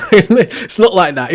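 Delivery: high and loud through a laugh, slowed; laughing denial. Real, not acted. Laughing while telling the viewer his accent isn't posh. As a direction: laugh it off while you deny it.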